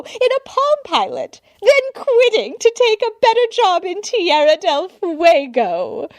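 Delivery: an admirer who can't contain herself; gushing society woman. A narrator's reading. Society woman gushing at the butler, high and loud. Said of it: "the woman continued to gush".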